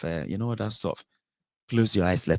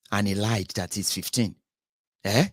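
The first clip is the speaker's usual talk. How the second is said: ordinary delivery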